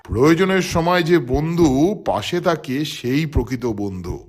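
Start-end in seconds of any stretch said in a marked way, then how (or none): none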